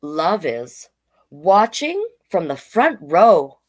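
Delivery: slow, sing-song picture-book read; reading to children. Acted. Drag queen reading a picture book to kids, slow and sing-song. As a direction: read each page slowly to the little ones.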